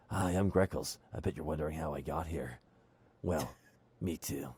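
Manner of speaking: low, quiet superhero-style narration; overdone brooding Batman murmur; too-Batman Greckles. Acted. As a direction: play it as a hushed, low Batman. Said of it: "Too Batman".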